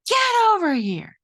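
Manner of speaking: high, loud sudden summons; mother yelling. Retold or imitated. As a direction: bark the order like an angry mom calling. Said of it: "just yell".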